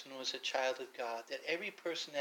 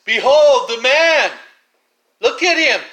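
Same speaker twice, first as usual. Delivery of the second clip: Pilate crying out; 9 semitones higher, 23 dB louder than usual. Retold or imitated. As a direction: bellow it out over the mob. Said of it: "As soon as he cried".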